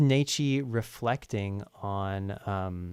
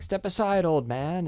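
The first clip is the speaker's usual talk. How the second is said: put-on cocky young man; 8 semitones higher, 4 dB louder than usual. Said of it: "And he's saying".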